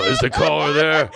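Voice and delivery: loud, slow talk-show host impression; Larry King. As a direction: call out slowly like a radio host taking calls. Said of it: "the old Larry King show".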